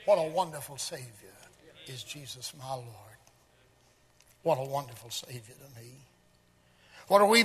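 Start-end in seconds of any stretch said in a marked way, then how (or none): 0.0-5.9 low, soft, hushed devotion; reverent refrain. Acted. Preacher praising his Savior between points, reverent and hushed. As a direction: drop it down low and quiet.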